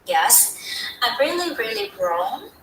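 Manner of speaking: ordinary delivery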